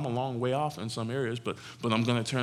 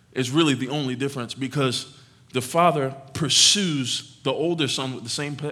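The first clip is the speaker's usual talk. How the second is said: ordinary talk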